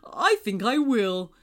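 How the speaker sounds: appreciative, stately moon voice; kindly moon character. A narrator's reading. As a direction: accept the child's offer kindly.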